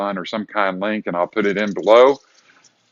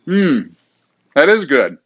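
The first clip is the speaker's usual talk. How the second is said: savoring delicious food; 5 semitones higher, 4 dB louder than usual. Real, not acted. Tasting the salad.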